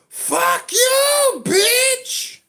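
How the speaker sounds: full-out, very high shriek; furious scream. Acted. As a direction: scream it at the top of your voice. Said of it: "you're kinda angry right now".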